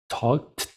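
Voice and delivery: "talked" with a detached T puff, "talk-t"; separated T option. Shown as a demonstration. Teacher offering options to choose from, separated final T. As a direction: say "talked" with the last T released on its own. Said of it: "¿cómo sonaría eso?".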